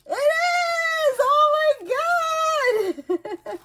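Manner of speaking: very high excited shriek; thrilled squeal. Real, not acted. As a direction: squeal with real delight, breaking into a laugh. Finds the card she hoped for.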